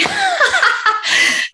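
high, real burst of laughter; laughing. Real, not acted. Student admitting she hates reading, high laugh. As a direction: laugh out high at your own admission.

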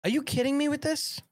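high, disbelieving outburst; can't believe this is real; incredulous. Real, not acted. Disbelief at the Target ornament.

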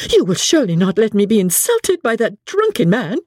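understated exasperation, voice lifted; passion of vexation. A narrator's reading. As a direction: plead with mild outrage. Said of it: "in a passion of vexation".

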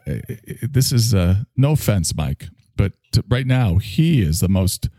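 normal talking voice

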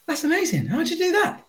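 high, astonished driver voice; high-pitched amazed man thanking the bees. Acted. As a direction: gasp it out like a stunned motorist. Amazed driver in bee joke.

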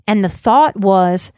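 ordinary delivery, nothing marked

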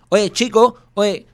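imitating an overacted Cuban accent. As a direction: parody a forced Cuban catchphrase. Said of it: "sobreactuado que diga".

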